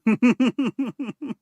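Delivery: high, loud cackle; loud laughter. Real, not acted. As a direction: burst out laughing. Laughing at news.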